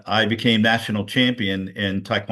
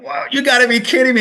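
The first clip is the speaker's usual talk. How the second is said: much higher, louder and fast, stunned; amazed disbelief; 13 semitones higher, 6 dB louder, faster than usual. Retold or imitated. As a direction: blurt it out like you can't believe it. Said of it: "and I'm like".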